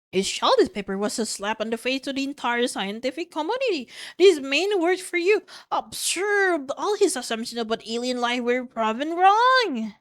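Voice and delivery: high-pitched outrage with emphatic peaks; indignant denunciation. Acted. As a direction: denounce a rival's work, swooping up on key words. Indignant scientist denounces a rival.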